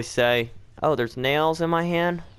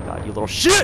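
louder startled curse at the creature; frightened yell; 4 semitones lower, 4 dB louder than usual. Real, not acted. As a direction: yell at the thing that found you. Monster appears, chases him.

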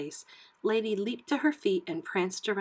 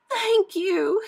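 high, loud, overcome with relief; gasping gratitude; 12 semitones higher, 7 dB louder than usual. A narrator's reading. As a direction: thank them in high-pitched surprise. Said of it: "The woman gasped".

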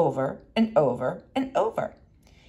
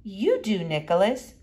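teacher's question; 6 semitones higher than usual. Retold or imitated. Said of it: "She had said".

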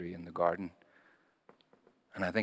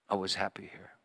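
much softer, letting the words sink in; soft echo; 3 semitones lower, 9 dB quieter than usual. Acted. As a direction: repeat it hushed, as if to yourself. Repeating Jesus' words, moved.

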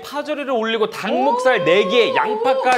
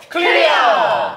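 excited; 5 semitones higher, much slower than usual. Real, not acted. Announcing a challenge cleared.

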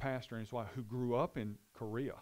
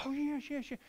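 much higher, bright eager yeses. Retold or imitated. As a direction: jump at the offer. Visitors eagerly accepting tour offer.

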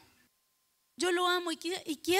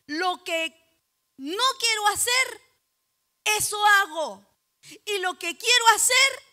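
loud, raised preacher's delivery with drawn-out phrase ends; forceful preaching; 5 semitones higher, 10 dB louder, slower than usual. Acted. Preacher quoting an apostle's confession to the congregation, forceful. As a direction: declaim scripture in punched, separated phrases.

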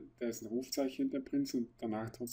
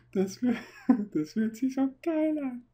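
put-on drunk delight; 13 semitones higher, 5 dB louder than usual.

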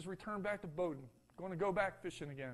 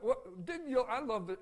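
normal talking voice

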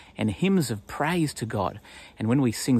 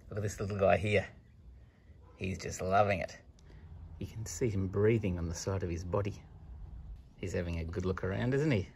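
soft, hushed voice so as not to scare the little animal; 4 semitones lower, 12 dB quieter than usual. Real, not acted. Presenter hushes while showing kids a little creature, softly. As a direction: lean in and keep it down.

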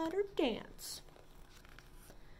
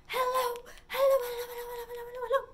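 rapid-fire hellos, quick and bright; fast hellos; 10 semitones higher, much faster than usual. Shown as a demonstration. Librarian leads kids in saying hello fast. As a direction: rattle off hellos as fast as you can. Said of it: "Let's say hello as fast as we can".

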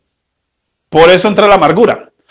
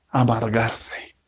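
low, slow, much softer; dramatic hush; 10 semitones lower, 15 dB quieter, much slower than usual. Acted. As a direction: drop it low and heavy, almost a sigh. Dramatic payoff word.

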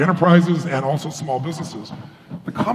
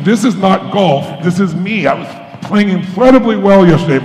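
indignant protest, raised and loud; retold frustration; 4 semitones higher, 7 dB louder than usual. Frustrated beginner protesting to his golf instructor, acted indignation. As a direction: protest hotly, drawing out the opening words. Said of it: "more and more pissed off".

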